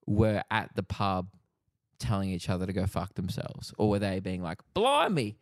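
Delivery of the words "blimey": put-on old-fashioned British grandparent. Imitating grandparents swearing politely.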